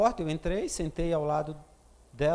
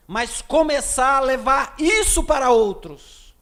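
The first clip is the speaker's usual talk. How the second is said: preaching at full force; 6 semitones higher, 8 dB louder than usual. Acted. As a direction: proclaim it to the whole church. Preaches the climax loudly.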